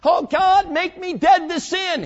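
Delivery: high, slow whining plea; mock pleading prayer. Believer begging God in prayer, high mock plea. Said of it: "we say".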